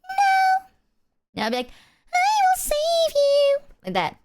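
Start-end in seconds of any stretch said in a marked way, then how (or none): 0.0-0.6 squeaky much higher no; high-pitched voice. Pretend. Her high-pitched reply in the song. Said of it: "my high-pitched voice". 2.1-3.6 very high, slow and louder; high-pitched voice. Pretend. Her high-pitched heroic reply in the song. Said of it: "my high-pitched voice".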